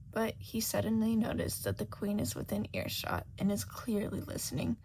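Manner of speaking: ordinary delivery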